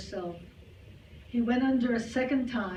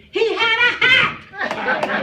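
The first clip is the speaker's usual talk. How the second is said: much higher, much louder, slow outraged cry; indignant complaint; 12 semitones higher, 12 dB louder, much slower than usual. Acted. Grandmother scolds heaven for losing the hat, outraged shout. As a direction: shout it up at the sky like she's been cheated. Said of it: "complained loudly".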